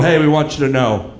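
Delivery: low, careful parent voice with pauses; solemn retold parent, halting and increasingly low; gently breaking bad news. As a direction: ease into sad news, slowly lowering the pitch. Parent breaking pet's death to daughter.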